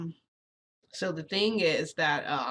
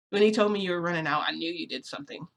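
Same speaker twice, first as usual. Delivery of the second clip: normal talking voice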